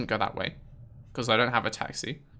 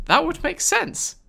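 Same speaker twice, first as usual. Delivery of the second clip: high, slow sarcastic drawl; mock the robot's answer. Real, not acted. Player answering the robot with high sarcasm.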